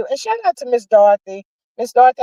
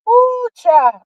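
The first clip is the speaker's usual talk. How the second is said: high excited ooh; 11 semitones higher, 4 dB louder than usual. Retold or imitated. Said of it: "my heart got".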